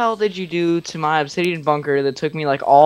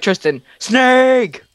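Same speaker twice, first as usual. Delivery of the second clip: prank scare shout; 9 semitones higher, 4 dB louder, much slower than usual. Shown as a demonstration. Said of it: "Dylan, just be like".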